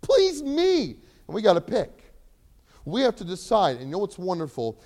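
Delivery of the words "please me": high, loud, drawn-out plea; begging plea. Acted. Preacher voicing the desires begging the listener, slow and pleading. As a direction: plead to be pleased.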